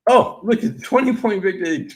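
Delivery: high, loud, excited repeat of the result; a bettor gushing over the score; delighted gush. Real, not acted. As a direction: say it again, now thrilled.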